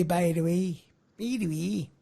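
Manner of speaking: lightly imitated Scottish catchphrase; a modest attempt at a Scottish accent. Shown as a demonstration. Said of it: "Scots always say".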